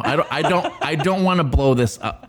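giggling as she tries to take it back; laughing. Real, not acted. Laughing while backpedalling.

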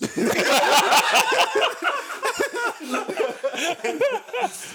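big high burst of laughter; cracking up hard; loud laughter. Real, not acted. Laughing fit at own story.

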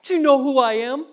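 high, loud, fast offended scolding; mock indignant rebuke. Imagined Jesus scolding disciples, hammed-up indignation. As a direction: snap back as if insulted. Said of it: "He doesn't rebuke them in the sense of".

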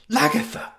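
sharp, high alarmed cry of the name; cried out. A narrator's reading. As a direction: cry out to stop her. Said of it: "cried Juliet".

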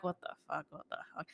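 hushed retold inner panic, nearly whispered; panicked under-the-breath aside. Teen thinking to herself in the car, hushed panic. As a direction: drop to a faint breathy whisper for the panicked thought. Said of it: "Like, freaking out".

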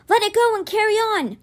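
high, urgent command; crying out. A narrator's reading. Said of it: "Rufus cried out".

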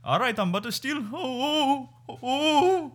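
high, climbing excited whoops; hammed-up cartoonish high cheering; mock baseball dad. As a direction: imitate a dad hollering a runner on. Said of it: "And then he's like".

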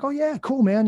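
high-pitched easygoing yes, mildly acted; breezy agreement. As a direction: retell an eager, casual acceptance. Retold breezy agreement to invitation.

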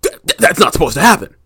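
high, loud, quick mock shock; hammed-up, rushed stuttering alarm; acted startled stammer. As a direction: stammer it as a stunned discoverer. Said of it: "And they're gonna be like".

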